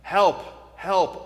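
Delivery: loud desperate call for help; man hanging from a branch calls for help; yelling for help. Retold or imitated. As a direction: cry out for help from the cliff. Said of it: "So he begins to yell".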